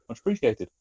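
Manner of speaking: ordinary delivery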